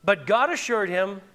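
normal talking voice

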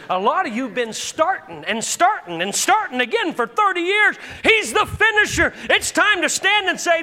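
rising preacher's shout, loud and high; fiery pulpit preaching; preaching at full force. Real, not acted. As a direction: build the sermon to a roaring climax.

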